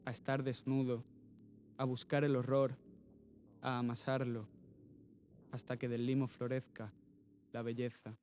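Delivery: sparse verse in short phrases, long pauses between; measured, earnest poetry reading; poem recitation. Acted. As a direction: read each short line alone, then pause. Reads untitled poem aloud.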